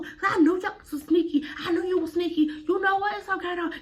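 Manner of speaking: a hint of the angry mother; mama scolding her daughter, lightly voiced; mock scolding mama.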